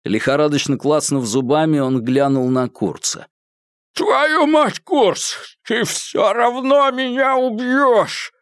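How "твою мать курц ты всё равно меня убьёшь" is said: high, slow, frantic yell; desperate shout. Acted. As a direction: scream it in despair. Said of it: "крикнул Малькольм". (отчаянный крик)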